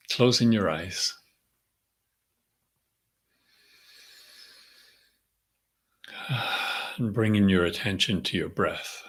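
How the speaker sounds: calm, unhurried meditation voice with long pauses; guided meditation. Real, not acted. Leader centering the group before the session, calm meditative prayer. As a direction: settle them gently into the breath, leaving space between phrases.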